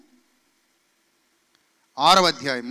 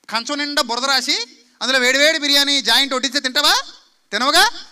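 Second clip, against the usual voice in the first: scolding rise; 6 semitones higher, 7 dB louder than usual. Acted. Preacher scolding the congregation.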